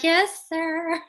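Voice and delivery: high, sweetly polite; polite employee. Retold or imitated. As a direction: fake politeness to the boss. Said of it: "They're like".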